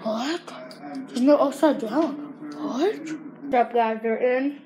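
talking around mouthpiece; mouth guard in. Real, not acted. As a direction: lips stretched over guard.

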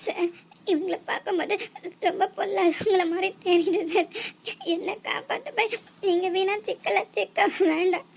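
tearful pleading. Acted. Girl tearfully pleading him to leave.

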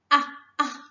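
"Ah, ah" as an open, short vowel. Shown as a demonstration. Teacher drills the class, repeated clear "ah". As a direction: model the short "ah" twice. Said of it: "This is called the short vowel sound".

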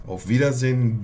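normal talking voice

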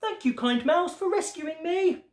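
high-pitched frog voice full of glee; gleeful little character voice, pitched high; grateful frog. A narrator's reading. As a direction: thank your rescuer in a high, delighted storybook voice. Rescued frog thanks the mouse.

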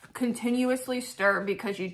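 muffled through napkin; talking while wiping mouth; napkin over mouth. Real, not acted. Seen 0: Napkin pressed to mouth at 1.2-1.5 and 2.8-3.5, lowered between.

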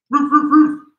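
high, loud playful barks; dog barking. Pretend. Coach barks like a dog during downward dog, playful. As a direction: bark like a puppy.

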